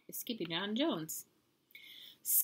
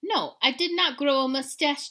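louder, higher, mildly indignant; indignant denial; 7 semitones higher, 7 dB louder than usual. A narrator's reading. Hero answers little dog, mildly indignant. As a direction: deny it firmly.

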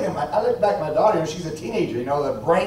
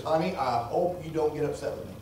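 softer, tentative wife on the phone; hint of a nervous wife bracing him; sheepish wife; 3 semitones lower, 10 dB quieter than usual. Preacher voicing his wife's hesitant confession, softer.

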